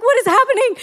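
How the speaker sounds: high excited question; bursting with amazement; to her son in the car, excited. Retold or imitated. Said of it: "We're so excited".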